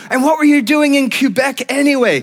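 high, loud interrogating mom; mock exasperated mother. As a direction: demand an explanation, playing the cross mom. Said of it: "Voicemail number three".

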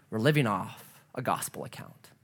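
low, soft, settled preaching; quiet assurance. Real, not acted. Preacher offers the gospel account, quietly assuring. Gentle answer to bankrupt account.

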